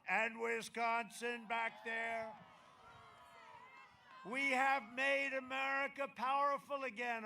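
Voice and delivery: shouted rally crescendo; rising, chanting rally build; rally speech. Acted.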